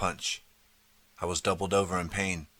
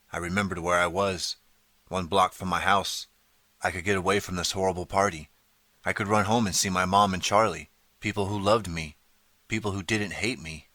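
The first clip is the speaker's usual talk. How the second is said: ordinary delivery, nothing marked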